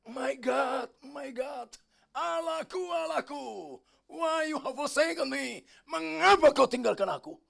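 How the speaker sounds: high, drawn-out cry to heaven; anguished loud cry. Retold or imitated. Christ on the cross crying to God, loud cry. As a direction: call out slowly, swelling to a shout. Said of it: "dia berteriak cukup keras".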